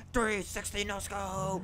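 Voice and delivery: stretched-out gamer brag; slow hype call. Real, not acted. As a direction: announce it like a highlight reel. Hyping his own trick shot.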